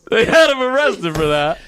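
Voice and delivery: high, strained through laughter; real laughing speech. Real, not acted. Storyteller revealing the brick arrest, high and laughing. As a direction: push the line out while cracking up.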